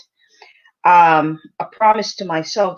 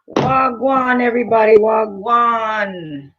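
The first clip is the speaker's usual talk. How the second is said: playful Jamaican patois greeting; 2 semitones higher, slower than usual. Pretend. Livestream host greets viewers in a mock Jamaican accent. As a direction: greet the room in a lightly put-on Jamaican accent. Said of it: "in my Jamaican voice".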